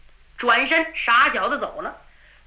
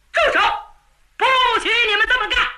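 much higher, louder, rapid shout; loud command; 8 semitones higher, much faster than usual. A narrator's reading. Voice at the door shouting the young generals down. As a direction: stop them cold from the doorway. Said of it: "门口有人高声道".

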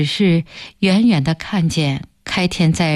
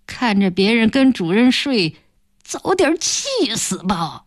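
taunting villain, mostly higher, with scornful snickers; cold sneer; 4 semitones higher than usual. A narrator's reading. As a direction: spit insults at her back, gloating and sneering. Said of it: "望着暖暖的背影冷笑道". (冷笑着尖声嘲骂)

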